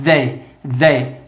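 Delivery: "They" with the tongue on the teeth and a Z-like buzz; voiced TH demo. Shown as a demonstration. Teacher demonstrating the voiced th to students, buzzing. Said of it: "eu tento produzir Z com a língua aqui no dente".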